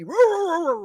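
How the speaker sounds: spoken mock dog noise; imitated dog bark. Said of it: "If the dog was back there".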